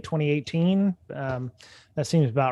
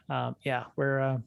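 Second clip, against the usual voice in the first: ordinary talk, nothing marked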